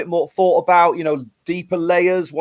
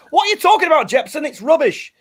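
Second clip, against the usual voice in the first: mock critic; 12 semitones higher than usual. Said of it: "people might go".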